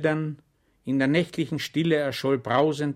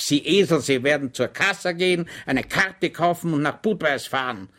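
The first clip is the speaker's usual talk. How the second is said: higher, louder scolding; emphatic order; 6 semitones higher, 5 dB louder than usual. Acted. The lieutenant orders Schwejk off, emphatic. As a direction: lay down the law. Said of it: "sagte er nachdrücklich".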